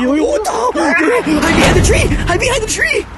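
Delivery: high, shouted panic; panicked shouting. Acted. Frightened friend screams at the group to hide behind the tree. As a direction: scream at everyone to hide now.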